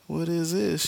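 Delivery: high, incredulous mock cry; astonished. Barry sees his living mom, astonished. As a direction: voice jumps up in disbelief.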